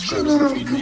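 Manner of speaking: high, loud whiny pet voice; cat voice. Pretend. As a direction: whine like a hungry pet. Playing the cat, whining.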